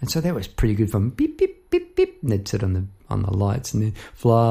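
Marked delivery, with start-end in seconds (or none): 1.1-2.2 very high little bird chirps; finch chirps. Retold or imitated. Telling about the escaped finch, very high chirping beeps. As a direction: chirp like a tiny zebra finch.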